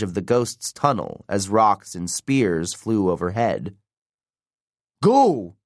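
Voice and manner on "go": high, urgent narrated yell. A narrator's reading. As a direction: yell an order to flee. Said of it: "Frank yelled".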